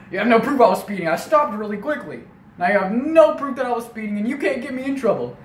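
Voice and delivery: loud, high-pitched insistence; indignant dad. Retold or imitated. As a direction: hammer the argument at the cop. Acted dad arguing with police.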